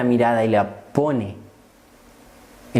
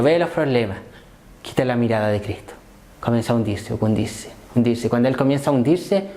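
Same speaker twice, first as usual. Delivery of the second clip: ordinary talk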